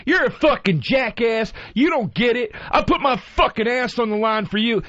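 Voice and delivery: high, mock furious yell of a veteran; imitating her screaming. Imitating the Navy woman screaming at him. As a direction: ham up her angry tirade. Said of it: "starts screaming at me".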